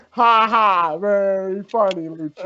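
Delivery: mock a scared kid putting on bravado; kid feigning bravery. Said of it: "little kid trying to prove to me he ain't scared".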